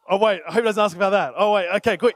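rapid, anxious rattle to himself; quick nervous muttering. Retold or imitated. Worker bracing for the boss's call, fast and nervous. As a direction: rush it out in nervous relief. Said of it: "you're going through".

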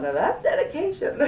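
laughing high called-out quote. Retold or imitated. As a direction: call it out across the room, amused. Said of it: "Just yelled out".

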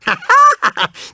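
high, falling villainous laugh; hammed-up gloating laugh; gleeful cackle. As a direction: cackle in triumph after overtaking. Gloating after passing.

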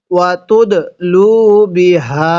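ওয়াতুদলু with a bouncing, echoing দাল; qalqala on দাল. Shown as a demonstration. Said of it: "দালের মধ্যে ধাক্কা দিয়ে পড়ব".

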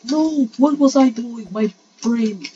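high, loud wail at oneself; frustrated outcry. Real, not acted. Player blaming own brain, high frustrated cry. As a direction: cry out over your own blunder.

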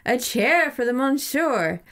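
mock French waiter. A narrator's reading. Alan playing waiter for the monsieur, hammed up. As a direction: play the fancy waiter, slowly. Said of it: "shouted Alan".

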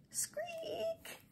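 high airy squeak noise; whispered squeak. Acted. Reader voicing the cable's squeak. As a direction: squeak it like a rusty crank.

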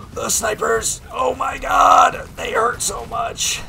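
high, slow, strained shouting; pained yelling. Real, not acted. Player hit by snipers, wailing high and slow.

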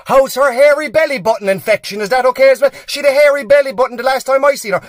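high, loud broadcast of a secret; shouting. Acted. Pharmacist asking about a mother's infection, shouted. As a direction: blurt it out at a shout for all to hear. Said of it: "but shout the wrong thing".

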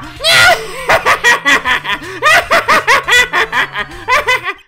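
long, high, loud manic laugh; clown cackle. Acted. Clown laughs alone at own joke, shrieking cackle.

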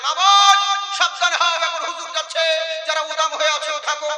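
high, loud mock call to the women; mock announcement. Preacher mimicking a call to warn women, hammed-up. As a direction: cry out the warning like a herald.

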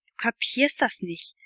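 ordinary delivery, nothing marked

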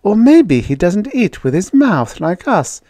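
put-on high girlish voice, lively tune; bossy girl thinking aloud; speculating girl. A narrator's reading. Judy speculates to Jane about the alien, undaunted.

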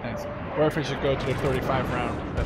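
ordinary delivery

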